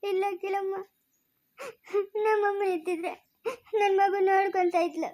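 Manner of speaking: helpless tearful telling, sobs between lines; crying. Acted. Mother tells how nobody supported her, crying. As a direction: recount the loss while weeping. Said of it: "ಅಳ್ಬೇಡ ಸುಮ್ನಿರು".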